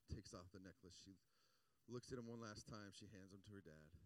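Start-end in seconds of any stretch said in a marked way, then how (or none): none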